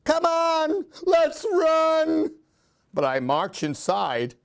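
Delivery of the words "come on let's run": much higher, louder panicked cry; frightened friends shouting to run; scared scream. A narrator's reading. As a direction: urge everyone to flee. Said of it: "My friends all scream".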